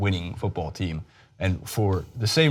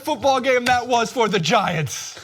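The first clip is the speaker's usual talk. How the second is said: announcer voice; 12 semitones higher than usual. Acted. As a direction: belt it like a mock TV announcer. Sports show opening announcer bit.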